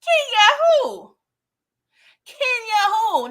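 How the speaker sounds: high, slow, stretched-out disbelief; shocked disbelief. Retold or imitated. Marvin hears about Kenya, shocked and high. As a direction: say the name like you can't believe it, way up high. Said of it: "He was like".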